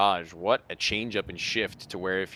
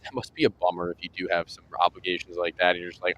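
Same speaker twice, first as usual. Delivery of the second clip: normal talking voice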